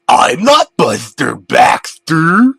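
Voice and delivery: slow, loud demonic bellow; demonic roar. Acted. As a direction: boom the reveal like a demon. Demon reveals itself.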